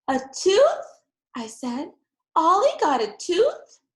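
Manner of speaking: high, loud rising disbelief; astonished. A narrator's reading. Girl hearing dad's news, astonished. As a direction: gasp out the question in wonder.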